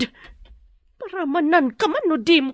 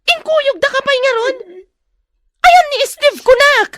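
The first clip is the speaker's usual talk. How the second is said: much higher and louder, yelling the demand; furious shout; 13 semitones higher, 9 dB louder than usual. Acted. Kidnapper screaming at the captive demanding where Steve is, furious shout. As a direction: scream the question at her.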